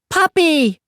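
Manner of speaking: put-on high, bright toddler voice; excited little kid. A narrator's reading. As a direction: squeal it like a thrilled toddler. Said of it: "the kid said".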